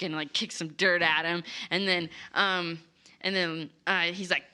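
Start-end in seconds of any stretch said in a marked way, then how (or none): none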